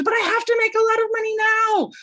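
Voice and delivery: high, fast, fretting whine of a worried business owner; mock panicky entrepreneur. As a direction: squeak out the money panic like a frantic newbie. Mocking anxious business owners.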